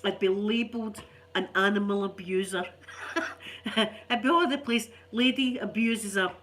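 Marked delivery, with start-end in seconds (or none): 2.7-3.7 laugh. Real, not acted. Laughing at imagined headline.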